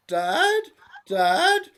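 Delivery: very high, loud pleading daughter calling Dad; high girlish plea; squeaky daughter. Acted. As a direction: whine it up like a lovesick girl.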